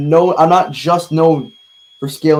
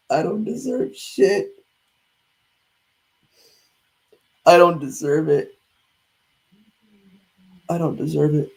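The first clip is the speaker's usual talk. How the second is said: crying with rage; 8 dB quieter, slower than usual. Real, not acted. Distraught after a loss.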